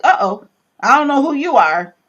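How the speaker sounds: acting out sudden wariness; alarmed wake-up call. Reacting to her mentor's remark, alarmed. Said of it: "like a wake-up call to me".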